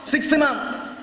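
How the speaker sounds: normal talking voice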